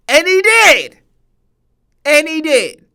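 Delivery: loud, high, drawn-out emphasis on DID; triumphant high shout; emphatic exclamation. Real, not acted. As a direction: belt it out to hammer the point. Emphatic point in heated debate.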